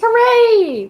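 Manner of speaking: high, loud little cheer; excited cheer. Real, not acted. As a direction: cheer the roll. Celebrating a roll.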